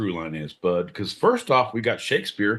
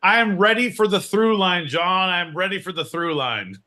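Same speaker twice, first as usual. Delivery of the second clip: mock eager; 8 semitones higher, 4 dB louder than usual. Eager call for segment.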